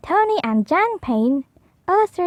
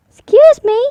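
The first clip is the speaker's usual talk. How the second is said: high, louder polite call; 8 semitones higher, 7 dB louder than usual. Acted. Portly calling up to a bat in the tree, loud polite.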